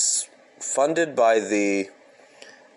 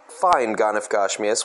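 ordinary delivery, nothing marked

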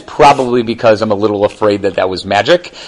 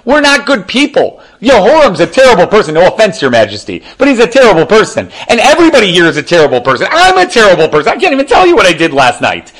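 high, louder self-condemning rant; Shlish lamenting; 7 semitones higher, 3 dB louder than usual. Acted. Officer telling Elisha, high anguished self-blame. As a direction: pile on the guilt, pitched high. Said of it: "said the Shlish to Elisha".

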